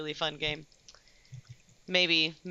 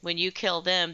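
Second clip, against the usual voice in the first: ordinary delivery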